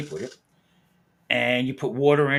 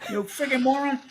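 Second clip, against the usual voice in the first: exasperated retort; 12 semitones higher, 4 dB louder, slower than usual. Retold or imitated. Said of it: "I said".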